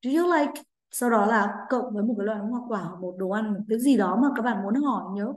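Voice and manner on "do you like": model question. Shown as a demonstration. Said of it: "Với mẫu câu".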